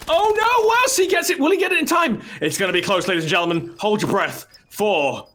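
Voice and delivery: high, loud cry of live commentary; frantic play-by-play. Real, not acted. Caster calling a last-second scan attempt, frantic and high. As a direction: call the countdown at fever pitch.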